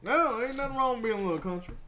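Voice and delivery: high, loud, fast southern twang; hammed-up southern country voice; southern accent. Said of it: "giving her a southern accent".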